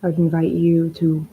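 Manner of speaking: unhurried, even-toned meditation leading; guided meditation. Acted. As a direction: ease listeners into stillness, phrase by phrase.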